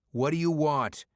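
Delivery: loud, forceful demand; demanding shout. Acted. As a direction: bark the question at the attacker. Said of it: "Maddox demanded".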